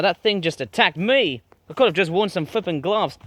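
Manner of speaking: ordinary talk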